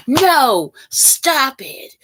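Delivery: emphatic, drawn-out refusal; firm mock scolding. Imagined bullies take away good news, mock scolding. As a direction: put your foot down, firmly. Acted refusal, repeated for effect.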